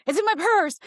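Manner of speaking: high, loud panicked blurt; panicked cry. Retold or imitated. At the gun range door, realizing her gun is in her purse, panicked high cry. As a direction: blurt it in sudden alarm. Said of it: "I, like, panicked".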